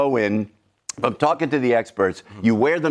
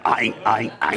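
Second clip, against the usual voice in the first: a stammering politician, pitched lower; a lightly done halting senator voice; Chuck Schumer impression; 7 semitones lower than usual. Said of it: "My Chuck Schumer impression".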